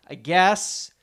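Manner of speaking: normal talking voice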